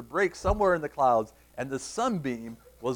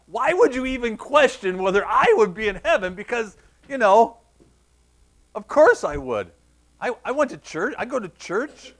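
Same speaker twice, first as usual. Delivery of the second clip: loud, high wounded-pride protest; indignant; 7 semitones higher, 7 dB louder than usual. Retold or imitated. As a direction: insist like an offended seventh grader. Kid's indignant thoughts acted out.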